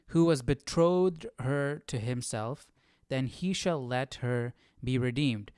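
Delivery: ordinary talk, nothing marked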